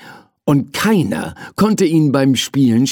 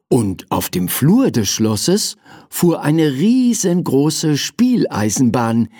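usual speaking voice

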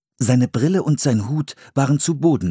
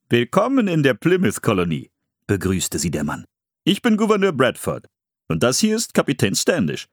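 a hint of a friendly official; lightly voiced governor; 3 semitones higher than usual. Governor Bradford welcoming the children to the colony.

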